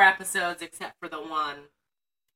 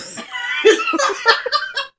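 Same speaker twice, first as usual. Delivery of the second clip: high laugh; 5 dB louder than usual. Real, not acted. Laughing at cock.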